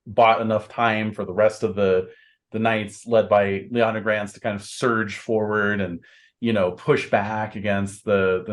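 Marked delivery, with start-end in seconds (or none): none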